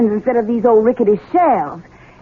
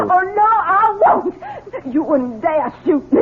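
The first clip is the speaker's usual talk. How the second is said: defiant; 7 semitones higher, slower than usual. Acted. Defying captor at gunpoint.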